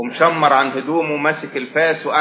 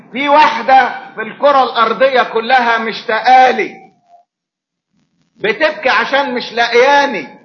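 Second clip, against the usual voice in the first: high, loud impassioned preaching; raised preacher's voice telling of her tears; fervent preaching; 8 semitones higher, 3 dB louder than usual. Acted. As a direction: preach it with rising fervour. Preacher building to a peak.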